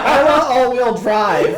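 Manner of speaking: high, loud, slow kid imitation; four-year-old girl. Four-year-old repeating the fed line, loud child imitation. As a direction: demand it like a small child. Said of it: "She's like".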